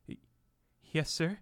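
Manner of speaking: a touch of nervous stutter; halting, timid yes sir; mild nervous stammer. Acted. Bellboy answering Trump, mildly nervous stammer. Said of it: "I said nervously".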